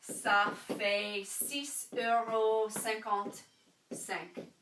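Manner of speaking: "Ça fait six euros cinquante-cinq" at half speed, each word spaced; slow French model. Shown as a demonstration. Teacher modelling a price for students, slow and careful. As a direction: drag it out for learners to follow.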